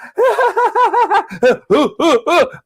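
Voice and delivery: long, high, loud re-enacted laugh; high cackle trailing into hoots; imitated laughter. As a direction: act out the laugh everyone heard. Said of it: "this was what you hear".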